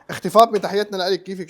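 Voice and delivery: loud, high hello to a friend; excited greeting. Real, not acted. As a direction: call out warmly and loudly. Greeting a viewer on the live.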